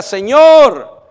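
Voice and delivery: loud, high call of "Señor" over the crowd; shouted cry. Acted. Canaanite woman cries out to Jesus over the crowd, loud and high. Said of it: "ella tiene que levantar su voz y ella grita".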